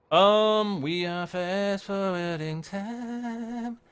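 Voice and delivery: ordinary talk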